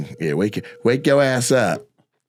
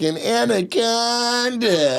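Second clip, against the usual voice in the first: put-on very high, slow Valley girl drawl; 10 semitones higher, much slower than usual. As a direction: stretch out anaconda, Valley girl style. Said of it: "she said, like".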